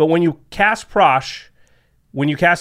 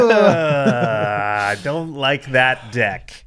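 drawn-out, very slow mock dread; slow dramatic dread; much slower than usual. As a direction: stretch every word like a doomed warning. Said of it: "You're like".

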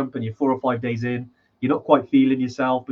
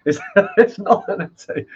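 laughing; 7 semitones higher, 7 dB louder than usual. Real, not acted. Laughing denial of the essay jab.

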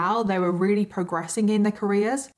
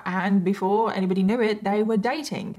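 normal talking voice

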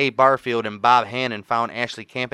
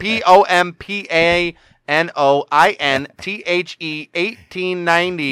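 slow, higher, exaggerated letter-by-letter spelling; mock spelling-out; 4 semitones higher than usual. Co-host teasing the reader for spelling, exaggerated letter-by-letter delivery. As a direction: tease by spelling everything painstakingly. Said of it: "just spell out everything".

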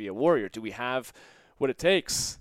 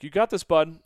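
loud encouragement; 5 semitones higher, 10 dB louder than usual. Retold or imitated. Cheering the scared boy on.

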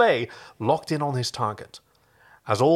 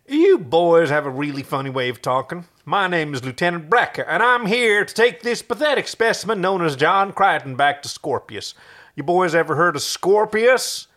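a lightly done southern drawl, louder; mocking a lieutenant in a lightly done southern drawl; 5 semitones higher, 5 dB louder than usual. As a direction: put on a southern drawl to taunt. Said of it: "in his best southern drawl".

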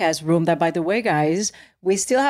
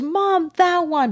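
high-pitched imitation of an eager child; excited little boy; 9 semitones higher, much slower than usual. Mother retelling her son's find at the mall, excited child voice. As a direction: imitate a kid calling Mom over.

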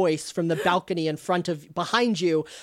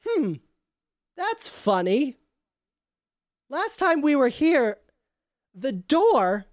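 higher NPC voice, puzzled; lightly voiced worried companion; Andera voiced; 7 semitones higher than usual. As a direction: play Andera, mildly puzzled. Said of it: "Andera says".

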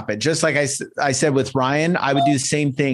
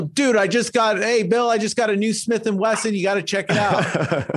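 excited buddy; 7 semitones higher than usual. Pretend. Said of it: "what would you say".

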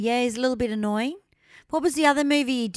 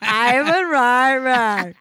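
loud, drawn-out mock Austrian accent; Schwarzenegger; 5 dB louder, much slower than usual. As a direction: ham up an Austrian accent, stretching each word. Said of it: "the way Schwarzenegger does it".

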